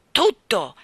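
a short loud growling snap; growled. A narrator's reading. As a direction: bark it out, fed up. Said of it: "egli ringhiò". (ringhio secco e forte)

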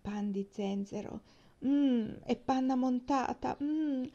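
slow, relishing mmm; savouring. A narrator's reading. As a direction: linger on it as if tasting dessert. Savouring a favourite dessert.

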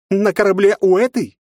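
loud high outrage; indignant. Acted. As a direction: scoff in disbelief. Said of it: "возмутилась воспитательница". (возмущённо)

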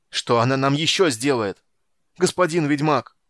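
shouting. A narrator's reading. Captain appeals to the witcher, desperate shout. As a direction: cry out to be answered. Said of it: "закричал Пудуарак".

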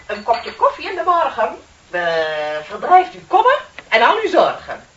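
sing-song ad slogan, hammed up, with a laugh at the end; mock advertising jingle. Mocking TV ad copy to her colleague, sing-song and laughing. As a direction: recite it like a cheesy coffee commercial. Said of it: "die reclameteksten maken".

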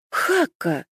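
high, delighted cry of a name; joyful exclamation. Acted. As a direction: call out an old friend's name with joy. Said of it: "воскликнула Исма". (радостный возглас)

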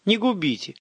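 high-pitched begging; pleading. A narrator's reading. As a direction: beg them for mercy. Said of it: "взмолился тот".

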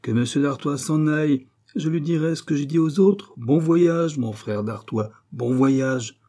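acted gleeful dismissal; eager send-off. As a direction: wave him off with relish. King gladly bids brother farewell.

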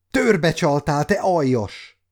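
high, loud accusing cry; outraged shout. A narrator's reading. Captive girl accusing the man who tricked her, shouting. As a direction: shout it at the traitor. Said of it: "kiáltott Bújtosra".

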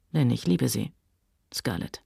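low, soft declaration of love; tender murmur. A narrator's reading. Man confesses love to his sweetheart, tender murmur. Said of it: "Sein Murmeln klang zärtlich".

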